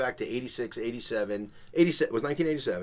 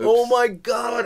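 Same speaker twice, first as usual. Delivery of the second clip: drawn-out panicked oh my God, higher and louder; freaking out; 11 semitones higher, 7 dB louder, much slower than usual. Retold or imitated. Young salesman panicking outside the club, slow high cry. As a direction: gasp it out slowly in panic. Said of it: "I'm freaking out".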